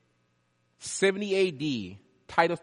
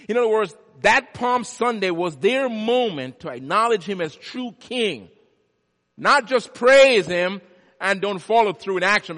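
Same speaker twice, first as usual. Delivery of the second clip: loud, high, impassioned sermon build; fiery preaching; 6 semitones higher, 11 dB louder than usual. Acted. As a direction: drive it home to the congregation. Preaching at full force.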